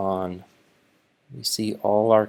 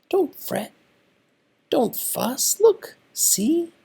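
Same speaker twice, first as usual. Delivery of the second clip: squeaky mouse. Acted. Mouse comforting the sad bear, high and squeaky. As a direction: squeak like a tiny kind mouse. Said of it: "his friend Mouse says".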